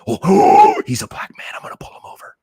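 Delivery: high loud gasp dropping to whisper; hammed-up horrified gasp, then whisper it; mock shock. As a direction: imitate a scandalized cop, shrieked "oh" into hushed whisper. Mocking an imagined cop's reaction.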